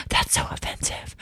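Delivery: scandalized whisper, no voice; hushed, mock-prudish gasp of disapproval; prim whisper. Imitating her younger prudish self, whispered. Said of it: "we're like".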